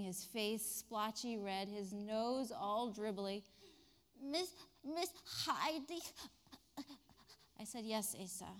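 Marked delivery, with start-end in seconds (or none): 4.2-6.0 put-on small sniffly boy voice, slow; a hint of a teary little boy; tearful child. Boy looks up at teacher, teary and small. 7.8-8.5 soft tender reply; quiet and kind; gentle. Retold or imitated. Teacher answering the crying boy gently.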